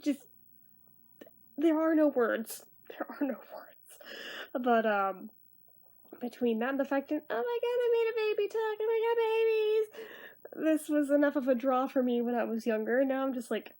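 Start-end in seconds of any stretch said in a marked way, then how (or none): 2.9-4.0 barely-there whisper; aghast whisper. Real, not acted. Reviewer speechless at the creepy graphics, whispered. 7.3-10.8 high-pitched squeal of excitement; mock gushing. Reviewer mimicking their younger self thrilled by talking babies, mock gushing.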